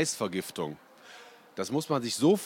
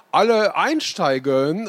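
slow, higher, louder call to board; Oma Inge calling out; 7 semitones higher, 8 dB louder, slower than usual. A narrator's reading. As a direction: call everyone onto the bus like a bossy granny. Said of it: "rief Oma Inge". (laut rufende Oma, langsam und höher)

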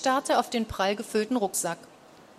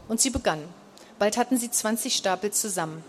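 usual speaking voice

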